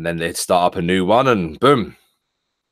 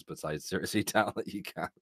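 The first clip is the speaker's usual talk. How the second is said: normal talking voice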